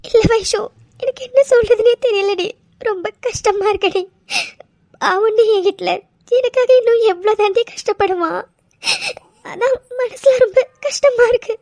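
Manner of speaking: high, crying voice breaking; choked-up tearful wife. Acted. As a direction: sob out the worry for him. Daughter crying over husband's ordeal.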